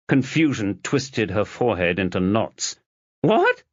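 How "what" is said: high, loud shocked yelp; girl stunned to learn her real parents; shocked what. Acted. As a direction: disbelief bursting out. Confusion at shocking news.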